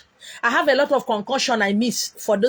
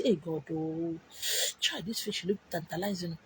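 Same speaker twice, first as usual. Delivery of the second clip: much softer, lower mock plea; quiet longing; 6 semitones lower, 13 dB quieter than usual. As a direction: murmur the craving to yourself. Craving muttered at fish.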